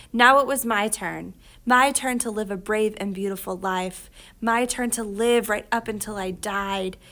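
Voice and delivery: ordinary delivery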